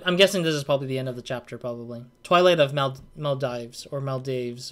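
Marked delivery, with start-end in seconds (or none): 3.2-3.9 Maldives said as MEL-dyvz, rhyming with hives; tentative pronunciation. Shown as a demonstration. Game streamer guessing at a chapter title, Maldives pronounced MEL-dyvz. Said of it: "don't know how to pronounce". 4.0-4.7 Maldives said as MEL-dayvz, rhyming with waves; alternative pronunciation. Shown as a demonstration. Game streamer trying another guess at the title, Maldives pronounced MEL-dayvz. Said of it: "don't know how to pronounce".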